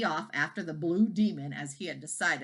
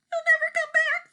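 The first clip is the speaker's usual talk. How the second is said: far higher, louder despairing wail; near tears; 7 dB louder than usual. A narrator's reading. Wife about to cry, high despairing wail. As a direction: let it break into a wail of despair. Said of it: "thought Missus Wagon Wheel".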